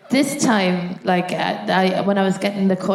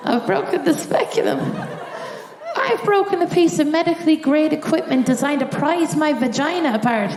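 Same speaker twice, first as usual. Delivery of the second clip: higher and incredulous, laughing through it; aghast; 6 semitones higher than usual. Retold or imitated. Comedian retelling the doctor's words to the crowd, aghast. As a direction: repeat the news in disbelief.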